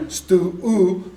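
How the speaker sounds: STU-U slowly stretched on its vowel; slow, sustained oo in STU-U; isolated syllable. Shown as a demonstration. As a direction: draw out the oo in STU-U. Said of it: "so there's one vowel".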